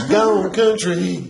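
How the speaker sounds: high, slow country drawl; hammed-up country holler; country accent. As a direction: stretch it out high in a mock country twang. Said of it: "you trying to be country".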